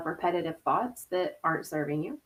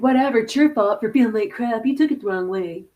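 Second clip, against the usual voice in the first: dismissive; 6 dB louder than usual. Retold or imitated. Voicing a dismissive reply.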